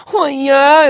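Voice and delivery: high, loud rowdy cheer; full-voiced imitation of screaming boys; retold screaming. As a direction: whoop it like a riled-up crowd. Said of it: "we're all screaming".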